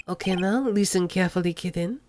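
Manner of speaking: put-on low, soft French accent, purring; smooth French accent crooning at the rookie; a hint of a lazy French accent. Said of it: "made Armando have a French accent".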